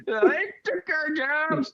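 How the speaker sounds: high, slow, whiny yokel; hammed-up angry local; mock bigot whine. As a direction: whine it like a cartoon xenophobe. Player mocks xenophobe.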